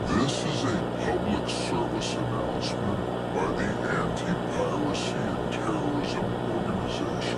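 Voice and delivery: eerie whisper, electronically warped; distorted whispered villain broadcast. Acted. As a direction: creep them out with a warped whisper. Sinister message from the organization.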